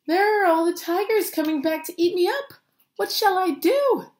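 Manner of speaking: high, loud, panicky fretting; alarmed little Rajani. A narrator's reading. Rajani sees the tigers coming back, high loud alarm. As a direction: fret loudly up high. Rajani fears being eaten.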